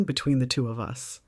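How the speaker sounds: usual speaking voice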